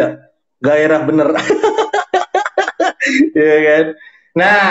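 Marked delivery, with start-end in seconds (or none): 1.3-3.3 short high burst of laughter. Real, not acted. Trainer amused by a customer's excuse, high laugh. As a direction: laugh high and brief at the joke. Trainer reacting to a funny example.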